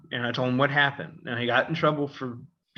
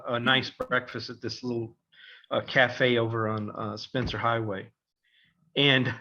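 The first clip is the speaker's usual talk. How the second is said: usual speaking voice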